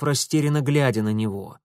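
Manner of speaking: usual speaking voice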